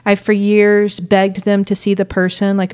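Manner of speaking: usual speaking voice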